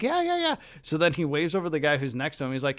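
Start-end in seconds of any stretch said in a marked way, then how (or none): 0.0-0.6 very high, quick imitated yeahs; eager old man. Bob Gurr voiced in story.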